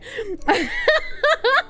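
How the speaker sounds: high-pitched laugh. Real, not acted. Laughs at own visa joke.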